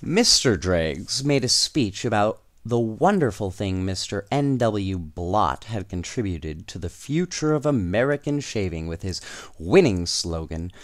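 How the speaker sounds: ordinary delivery, nothing marked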